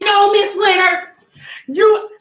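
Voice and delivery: high, loud teacher scolding; stern teacher. Retold or imitated. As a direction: stop her at the door, sternly.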